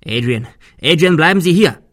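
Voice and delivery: loud call after someone running out; calling after him. Acted. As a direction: shout to stop him leaving. Calling after fleeing man.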